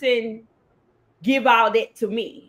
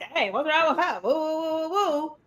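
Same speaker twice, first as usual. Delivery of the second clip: higher-pitched mock fussing, tailing into woo-woos; mock upset; 5 semitones higher, 3 dB quieter than usual. Host imagines reacting to a friend's cold shoulder, mock upset. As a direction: act out a light, pretend upset. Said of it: "I could get upset and be like".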